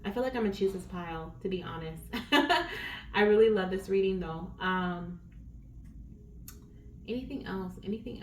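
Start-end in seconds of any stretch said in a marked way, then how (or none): 2.1-3.1 soft breathy laugh. Real, not acted. Laughs about choosing the pile.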